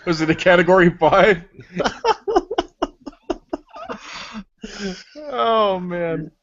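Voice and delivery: asked through laughter; chuckling question; laughing. Real, not acted. Laughing after a joke.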